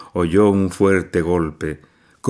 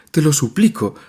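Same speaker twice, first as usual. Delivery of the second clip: higher and louder, begging hard; desperate plea; 9 semitones higher, 4 dB louder than usual. A narrator's reading. Nadia begging her mother to let her leave, desperate. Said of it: "profirió entre sollozos".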